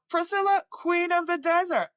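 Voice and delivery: high, sing-song patient quip; imitated patient voice, pitched well up; mimicking a patient. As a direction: toss off the film reference like an amused patient.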